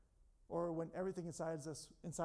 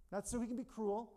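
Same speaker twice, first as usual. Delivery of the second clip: usual speaking voice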